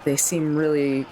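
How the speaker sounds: ordinary delivery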